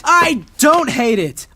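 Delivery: loud, high and slow, shouting back; heated denial. Acted. Son shouting back at his father, heated. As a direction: push back hard against the accusation.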